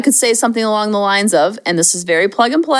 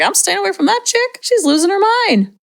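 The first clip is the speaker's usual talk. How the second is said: put-on kids' wary aside; 8 semitones higher than usual. Kids about their yelling parent, imitated wary aside. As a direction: imitate a kid backing away, pitched up. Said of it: "They're like".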